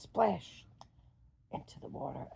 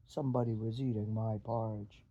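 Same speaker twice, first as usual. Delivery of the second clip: lower, gruff-sounding big papa bear; 7 semitones lower than usual. Acted. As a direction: drop the voice low for the big bear. Papa Bear voiced in story.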